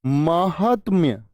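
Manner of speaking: drawn-out, syllable by syllable; teacher says महात्म्य slowly so students catch it; slow spelled-out answer. Shown as a demonstration. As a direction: stretch महात्म्य out slowly, each syllable clear. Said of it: "क्या होती है?".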